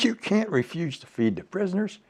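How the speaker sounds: high, forceful reprimand; scolding officer. Retold or imitated. Retold heated argument with colonel.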